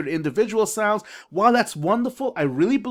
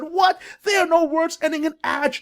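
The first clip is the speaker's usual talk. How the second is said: mock disbelief; 8 semitones higher than usual. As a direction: play the shocked parent who can't believe it. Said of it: "You said".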